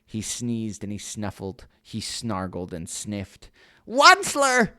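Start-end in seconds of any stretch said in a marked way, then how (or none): 3.9-4.7 high, loud croaking call of the name; croaking cry. Acted. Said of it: "he cried with a kerfufflous croak".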